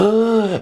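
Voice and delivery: short acted gasp; scared gasp. Middle goat facing the troll, acted gasp. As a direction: pretend a startled intake of breath. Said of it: "He went".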